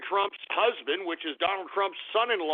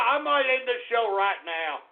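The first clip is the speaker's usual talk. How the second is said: ordinary talk, nothing marked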